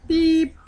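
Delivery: high, loud censor beep sound; mouth bleep. Pretend. Self-censoring a rude word.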